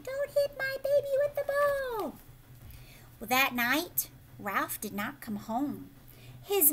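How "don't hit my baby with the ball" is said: high squeaky mother mouse; mouse mommy. Acted. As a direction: protective little mouse mom, pitched up high. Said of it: "the mouse mommy is saying".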